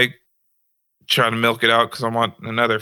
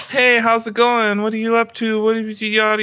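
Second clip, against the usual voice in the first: put-on high bubbly girl voice; 11 semitones higher than usual.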